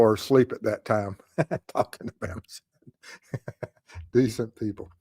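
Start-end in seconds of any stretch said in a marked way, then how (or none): 1.4-4.1 breathy laughed-through words, much softer and slower; laughing. Real, not acted. Laughing at viewer comment. 4.1-4.9 "Decent people" repeated through a soft real chuckle, a bit quieter; laughing. Real, not acted. Repeats comment while laughing.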